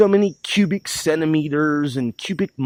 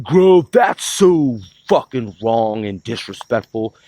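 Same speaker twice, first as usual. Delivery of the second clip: mocking critics; 5 semitones lower, 4 dB louder than usual. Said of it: "I know people like".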